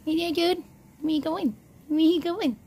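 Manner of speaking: high-pitched pet voice; pet talk. Real, not acted. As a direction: coo high at your little pet. Talks to pet iguana.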